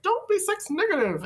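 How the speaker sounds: a mock-preachy voice scolding you to be sex positive; third inner voice. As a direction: squeak it out like a nagging inner critic. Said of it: "there's this third voice".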